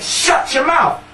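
very high, drawn-out comeback; girl's triumphant retort. Retold or imitated. Girl retelling how she silenced the bully, triumphant retort. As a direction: imitate a proud girl snapping back. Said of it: "And I went".

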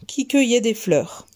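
usual speaking voice

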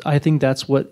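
ordinary delivery

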